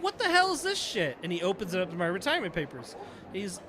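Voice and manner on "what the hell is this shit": hammed-up outraged boss; mock angry captain. Captain confronting him over retirement papers, mock outraged. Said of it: "He's like".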